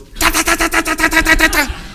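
high, stuck mock stutter; nervous stammer. Preacher imitates nervous Danny stammering at the door, high and stuck. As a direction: stammer like a terrified new boy at the door. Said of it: "he's so nervous, he goes".

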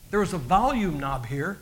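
normal talking voice

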